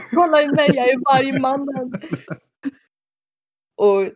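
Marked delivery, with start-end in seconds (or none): 0.1-1.9 high, loud gleeful boast; giddy boast. Retold or imitated. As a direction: show off, thrilled. Kenzie dancing in Dyson's body. (hög, förtjust skrytröst)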